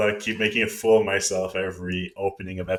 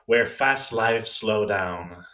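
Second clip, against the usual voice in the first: slow reading; much slower than usual. Acted. Reading the sign slowly.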